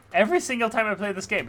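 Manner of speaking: fast loud exasperated repeat; exasperated outburst. Real, not acted. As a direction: blurt it out in disbelief. Exasperated at stuck climb.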